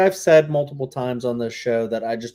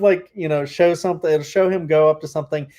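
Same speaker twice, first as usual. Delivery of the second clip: normal talking voice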